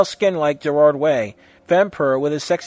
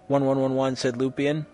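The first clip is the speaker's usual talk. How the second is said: ordinary delivery, nothing marked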